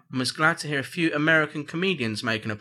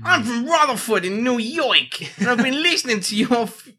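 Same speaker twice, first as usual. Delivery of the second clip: mock New York accent; 7 semitones higher, 3 dB louder than usual. Host reading out a listener's email, hammed-up New York accent. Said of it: "Shall I read it in that voice?".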